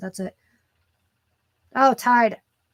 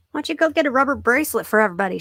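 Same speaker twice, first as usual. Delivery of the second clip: ordinary talk, nothing marked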